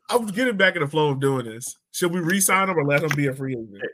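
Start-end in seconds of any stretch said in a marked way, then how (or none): none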